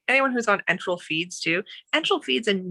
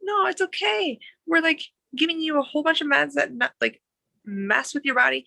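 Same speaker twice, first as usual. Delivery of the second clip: higher, gentle reassurance; a nurse comforting an embarrassed patient, gentle and higher; reassuring nurse; 9 semitones higher than usual. Retold or imitated. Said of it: "And it's like".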